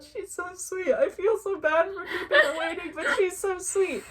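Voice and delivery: high, laughing fluster; acted-out overwhelmed fangirl squeal; retold gushing. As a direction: gush guiltily, half laughing. Gushing guilty thoughts acted out.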